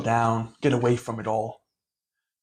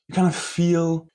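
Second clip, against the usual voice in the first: ordinary talk, nothing marked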